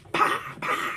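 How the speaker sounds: two sharp breathy smacks for the blows; punch sound effects. Retold or imitated. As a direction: mouth the thud of two quick body shots. Punches landing on ribs.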